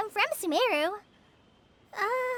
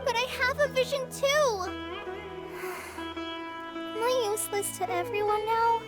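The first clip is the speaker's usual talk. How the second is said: higher-pitched, hurt plea, slowing at the end; upset protest; 4 semitones higher than usual. Acted. Trainee pleads with master to join patrol, upset. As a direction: protest upset, with a heavy sigh midway.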